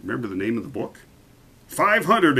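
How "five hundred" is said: high, loud delighted cry; the king exclaims at the price; exclaimed. A narrator's reading. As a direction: burst out with joy. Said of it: "exclaimed the king".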